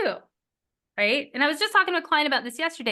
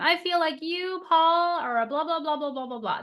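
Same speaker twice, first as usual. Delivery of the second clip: higher, accusing "I feel like you, Paul"; the wrong way, higher and blaming; accusing thought; 6 semitones higher than usual. Shown as a demonstration. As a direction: sound like you're starting an attack. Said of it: "That's, that's a thought".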